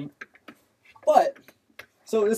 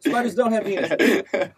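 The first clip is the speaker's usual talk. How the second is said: laughing; 8 semitones higher than usual. Real, not acted. Laughing restatement.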